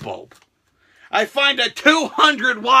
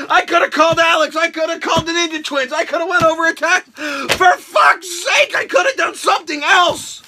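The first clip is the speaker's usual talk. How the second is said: loud, raised angry listing; angry rant; 7 semitones higher, 4 dB louder than usual. Acted. Still yelling at the friend, loud and raised. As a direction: vent every missed option.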